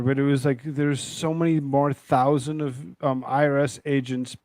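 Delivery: ordinary talk, nothing marked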